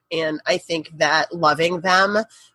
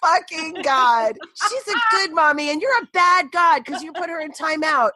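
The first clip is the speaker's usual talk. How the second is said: high, insistent mock prayer; acted childish pleading, pitched high; imitating bargaining child; 8 semitones higher than usual. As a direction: bargain with God like a cross little kid. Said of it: "there they are negotiating with God".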